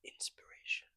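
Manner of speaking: very soft, whispered mock-inspirational word. Host testing openings, hushed and mock-inspirational. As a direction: whisper it as a mystical slogan. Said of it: "which one of those you think was the most inspirational".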